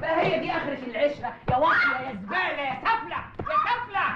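yelling insults at the caught wife; angry shout. Acted. Doorman scolding the caught wife, furious shout.

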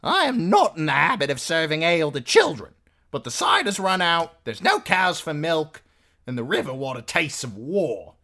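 high, loud, emphatic woman; bossy innkeeper's wife, scolding. A narrator's reading. Innkeeper's wife refusing children ale, loud high scolding. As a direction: lecture them, pitched high and loud.

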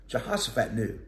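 ordinary delivery

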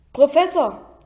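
a loud call of Professor across the room. Acted. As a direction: call the teacher across the dungeon. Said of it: "rief Malfoy".

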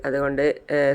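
ordinary delivery, nothing marked

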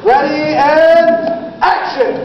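loud, drawn-out director's cue; calling action. Acted. Director starting the scene, calling out loudly. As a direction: stretch it out, then launch the scene.

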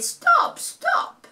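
very high squeaky stop; tiny high girl's cry; little girl squeal. A narrator's reading. Little girl voiced in story.

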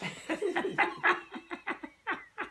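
a burst of high laughter at his own joke. Real, not acted.

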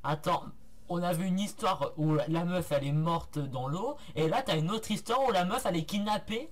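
loud, fast, amazed disbelief; almost shouting in surprise; excited. Real, not acted. Streamer reacts loudly to plot twist.

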